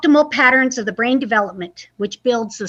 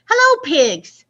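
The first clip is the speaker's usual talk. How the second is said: higher, louder hello; chirpy duck voice; 5 semitones higher, 4 dB louder, slower than usual. Acted. Duck greets the pigs, high chirpy voice. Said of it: "said Duck".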